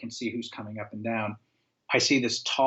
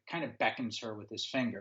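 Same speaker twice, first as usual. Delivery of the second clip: usual speaking voice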